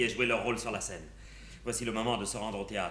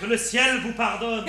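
hammy stage actor; 11 semitones higher, 8 dB louder than usual. Acted. Overplayed pious Tartuffe line.

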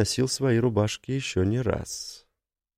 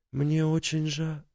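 higher, soft, slow, trailing off; sympathetic; 8 semitones higher, 4 dB quieter, slower than usual. Acted. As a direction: start a sorry, halting. Tina's sympathy cut off.

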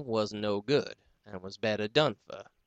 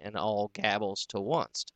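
usual speaking voice

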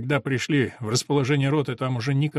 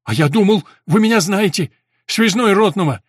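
loud, higher, taken aback; surprised; 7 semitones higher, 8 dB louder than usual. A narrator's reading. Messenger surprised Boris doesn't know him, loud. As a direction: protest in surprise. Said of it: "удивился посыльный".

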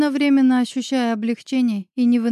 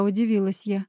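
ordinary delivery

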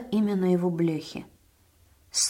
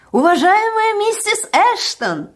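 very high, loud, stretched call; shouting from afar; 10 dB louder than usual. A narrator's reading. Dixon calling to Mrs Ashton from afar, shouting. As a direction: hail her across a distance. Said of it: "ещё издалека закричал Диксон".

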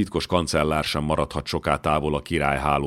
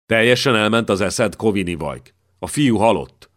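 higher and louder, furious rebuke; angry outburst; 5 semitones higher, 5 dB louder than usual. Acted. Chamberlain rounds on the servant over the dead boy, furious. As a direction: berate him as if he lost his mind.

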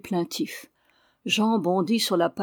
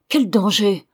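louder, fervent outburst; sudden exalted cry; 6 dB louder than usual. Acted. Old father cries out fearing for Tremle's grandson, exalted. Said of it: "s'écria le vieillard avec une soudaine exaltation".

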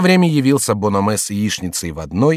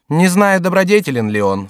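higher-pitched jester's reply; lightly voiced jester; 9 semitones higher, 5 dB louder than usual. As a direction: answer in a high, lively character voice. Chicot answers the monk.

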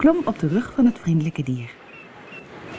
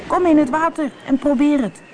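put-on high, encouraging boy's call; raised, urging voice; 11 semitones higher, 4 dB louder than usual. A narrator's reading. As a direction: call her in, pitched up. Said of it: "riep hij".

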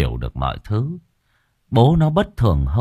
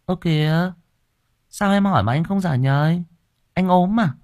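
worried wife; 10 semitones higher than usual. A narrator's reading. Wife worried, husband silent.

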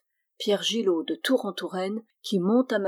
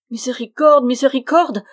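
loud, high plea for mercy; panicked cry; 5 semitones higher, 9 dB louder than usual. A narrator's reading. Terrified friar begging after the thunderclap, panicked. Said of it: "répéta le frère Bruno affolé".

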